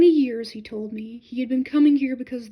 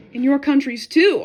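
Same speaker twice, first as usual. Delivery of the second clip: sharp, louder retort; 2 semitones higher, 11 dB louder than usual. A narrator's reading. Narrator retorting to the German monk, sharp and loud. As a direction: snap it back as a rebuke. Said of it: "I answered sharply".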